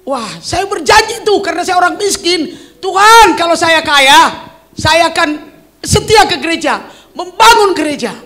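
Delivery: a sick man retelling his youthful vow to God, loud and slow; earnest vow. Retold or imitated. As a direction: swear it to God like a poor young man.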